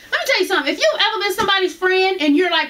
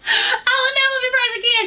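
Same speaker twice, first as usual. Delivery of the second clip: higher, half-crying comic wail; whiny mock wail; 9 semitones higher than usual. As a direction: whine it like a dramatic vow through laughter. Repeats the vow for a laugh.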